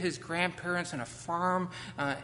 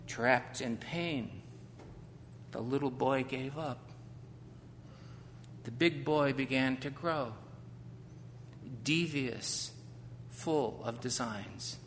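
poem reading; 3 semitones lower, 6 dB quieter than usual. A narrator's reading. Reads his own poem aloud.

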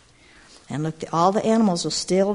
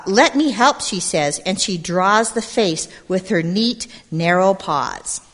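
put-on lively read-aloud to a group of kids; storybook narration; 6 dB louder, slower than usual. A narrator's reading. Storyteller reading to gathered children, lively narration. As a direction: tell it like a picture book at storytime. Reads picture book aloud.

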